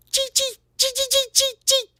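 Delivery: very high, sharp little squeaks; rapid-fire taunting chirps; critter chittering. Acted. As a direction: squeak teasingly like a tiny rodent. Homie squeaks to taunt the cat.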